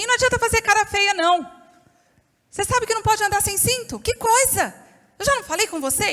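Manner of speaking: mother scolding. Retold or imitated. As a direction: tell her off, fast and exasperated. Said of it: "aquela coisa de mãe".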